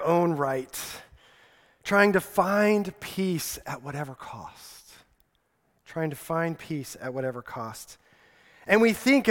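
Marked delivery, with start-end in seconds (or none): 5.9-8.0 soft echo. Shown as a demonstration. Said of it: "repeated after plain take".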